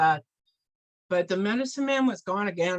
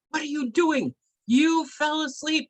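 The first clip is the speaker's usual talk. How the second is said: angry scolding; 11 semitones higher, 4 dB louder than usual. Acted. Medicine man catches helper asleep again, angry scolding. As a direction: act an outraged telling-off, each word stretched.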